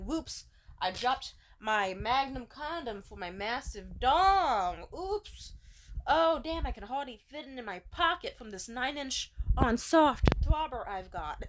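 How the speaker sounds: slow, put-on showoff voice; a parody of a man showing off; hammed-up bragging man. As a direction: ham up a fake oops boast.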